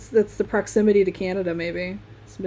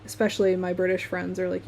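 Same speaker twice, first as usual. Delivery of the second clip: normal talking voice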